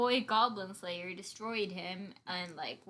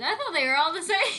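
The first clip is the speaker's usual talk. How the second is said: laughing; 8 semitones higher, 8 dB louder than usual. Real, not acted. Laughing at own confession.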